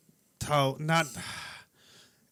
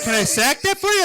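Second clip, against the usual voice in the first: higher, louder mimicked cashier; imitated store clerk; 9 semitones higher, 7 dB louder than usual. Clerk asking a customer at the gas station, imitated, higher and louder. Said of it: "And she's like".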